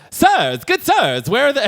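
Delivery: loud, high, lisping mimicry; ridiculous lisp. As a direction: lisp "Sirs" absurdly, thick on the s sounds. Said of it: "ridiculous, like, ridiculous uh, uh, lisp".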